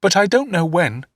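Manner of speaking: lightly voiced small boy, unsure; timid young boy. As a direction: answer nervously to the stern stepfather. Young David answering timidly.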